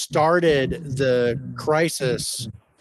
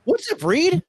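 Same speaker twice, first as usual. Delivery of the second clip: cracking up high on a greeting; greeting squeaks way up high; voice went high. Real, not acted. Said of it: "I think my voice went high on that one".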